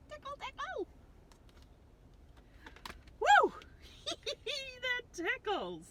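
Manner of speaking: high, squealing, laughing voice; giggly Larry. Acted. Larry laughs as the kids tickle his tummy, high giggles. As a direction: squeal like you're being tickled.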